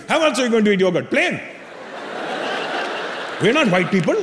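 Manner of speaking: imitated Indian dad, dismissive; dad's Indian accent. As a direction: retort as an indignant Indian dad. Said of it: "He goes".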